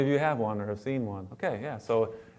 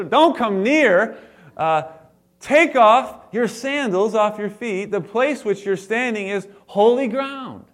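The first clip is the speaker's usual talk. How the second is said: commanding God; 7 semitones higher, 6 dB louder than usual. Acted. God commanding Moses from bush.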